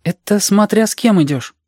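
put-on high child voice, countering; objecting child. A narrator's reading. Reshka objecting to Alexander's doubts, high child voice. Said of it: "возразил Решка".

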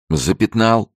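high, lively cry of a game; laughing playful call. A narrator's reading. Francis touching the girl's shoulder in a game, playfully amused. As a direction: call out the tag as a merry tease. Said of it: "рассмеялся он".

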